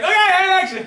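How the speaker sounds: loud, high-pitched hurried cue; frantic mock director. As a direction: yell it over the mock hysterics. Parodies frantic start of scene.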